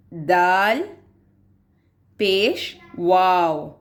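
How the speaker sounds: دال پیش واو said slowly, letter by letter; slow letter-by-letter spelling. Shown as a demonstration. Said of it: "this is bigger than".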